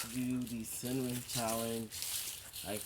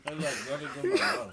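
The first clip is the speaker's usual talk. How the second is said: laughing; 3 semitones higher, 8 dB louder than usual. Real, not acted. Mid cinnamon challenge, laughing.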